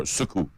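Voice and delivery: "Suku" as suh-KOO; short first syllable in "Suku", stress on the last; corrected pronunciation. Shown as a demonstration. Host trying out the corrected name, "Suku" stressed on the second syllable. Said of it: "I like that".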